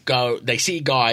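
normal talking voice

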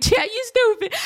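high, playful teasing with a laugh; joking. Shown as a demonstration. Couples kidding each other, high joking tease. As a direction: toss it off as a joke insult. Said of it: "Like, if we joking".

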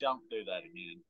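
low, hushed mock reproof; imitated fiancee scolding. Fiancee objecting to his accent slip, soft low imitated scolding. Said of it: "the fiance is like".

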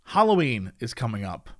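low, slow spooky storyteller; spooky announcer. Acted. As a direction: creep it up like a campfire tale. Spooky ad read.

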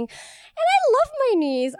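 high, plaintive comic wail; plaintive lament. Real, not acted. Storyteller moaning to audience about her injured knees, plaintive.

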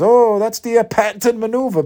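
high hammed-up announcer quote; mock Vince announcer. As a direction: ham up the commentator's pitch. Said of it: "And Vince says".

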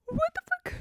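squeaky, very high incredulous exclaim. Real, not acted. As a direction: squeal it in disbelief.

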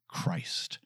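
"Christ" breathed in a whisper. Shown as a demonstration. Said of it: "You say that at a different cadence".

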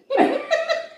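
squealing laugh, pitched very high; high squeal of laughter. Real, not acted. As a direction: crack up at your own slip. Laughing at name slip.

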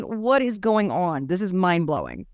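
ordinary talk

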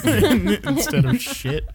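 laughing. Acted. Silly euphemism, laughing.

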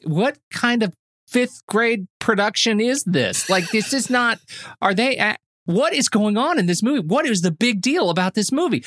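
exasperated disbelief. Retold or imitated. Viewer griping about a film's clumsy opening, exasperated. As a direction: demand answers in baffled exasperation. Acting out his baffled reaction to film.

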